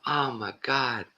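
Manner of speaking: high, slow mock "stunned" voice; imitated aghast reaction; shocked dismay. Retells comedian's shock at the news.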